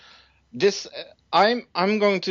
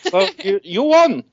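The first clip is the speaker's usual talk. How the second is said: excited announcement; 10 semitones higher, 9 dB louder than usual. Real, not acted. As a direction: voice jumping high and loud. Announcing surprise winner.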